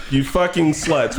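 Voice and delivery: usual speaking voice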